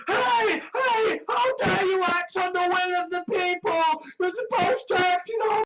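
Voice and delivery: high-pitched indignant wail; hammed-up protest, pitched well above normal; mock outrage. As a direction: cry out in exaggerated indignation. Acts out outraged defenders of the vote.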